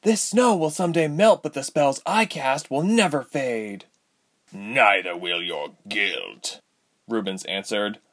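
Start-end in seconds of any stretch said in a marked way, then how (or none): 0.0-3.9 high defiant vow; defiant girl. A narrator's reading. Ilse defiant. 4.5-6.6 menacing villain. A narrator's reading. Rubens voiced.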